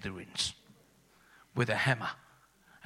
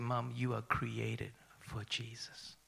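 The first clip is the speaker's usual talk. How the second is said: slow, soft whisper; tender whisper; 4 dB quieter, slower than usual. Retold or imitated. Son comforts his dying mother, slow tender whisper. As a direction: whisper it slowly at her bedside.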